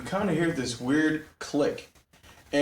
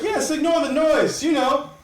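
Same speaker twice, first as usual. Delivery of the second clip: much higher and louder, cracking into laughs; zany high voice; 12 semitones higher, 4 dB louder than usual. Acted. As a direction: squeal it out like a giddy madman. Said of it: "And he's like".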